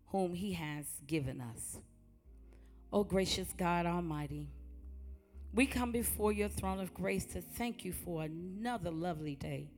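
ordinary talk

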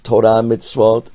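usual speaking voice

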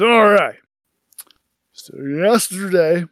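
rambling tipsy tale, pitch wandering; drunk storyteller. Acted. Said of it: "pretty well into his cups".

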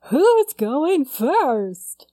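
slow, high, spooky drawn-out ghost; Southern ghost guy voice. Shown as a demonstration. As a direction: stretch it out like a haunting Southern ghost. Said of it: "like the Southern Ghost guy".

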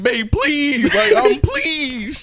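high, drawn-out begging; retold crying plea. Boyfriend begging his girlfriend to stay, retold crying plea. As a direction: imitate tearful pleading, stretching out the last please. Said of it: "realest I ever cried for real".

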